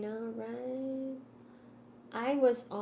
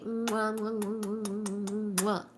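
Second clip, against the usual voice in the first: slow smacking air kisses; blown kisses; slower than usual. Real, not acted. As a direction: blow kisses goodbye. Goodnight kisses to viewers.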